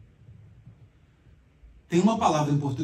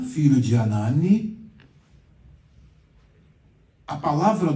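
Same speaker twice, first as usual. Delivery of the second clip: scripture reading; 2 semitones higher, 6 dB louder than usual. Acted. Reads Bible passage aloud.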